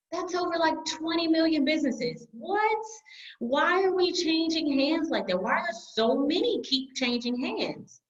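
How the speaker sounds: high, incredulous disbelief at the numbers; what, twenty million?; astonished. Real, not acted. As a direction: voice shooting up in amazement.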